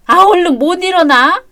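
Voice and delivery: exasperated mother's sharp order; nagging wake-up call. Acted. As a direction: scold a sleepyhead out of bed. Mother orders son out of bed.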